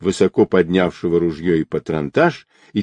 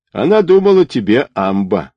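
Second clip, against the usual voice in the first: ordinary delivery, nothing marked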